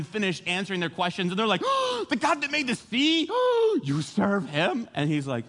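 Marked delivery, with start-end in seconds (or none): 1.6-4.9 high, gasping disbelief; mock freak-out. Sailors hear which God Jonah serves, high hammed-up shock. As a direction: gasp and squeal it like a shocked crowd. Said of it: "they're freaking out".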